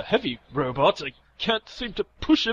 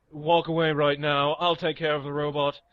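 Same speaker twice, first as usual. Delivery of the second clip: ordinary talk, nothing marked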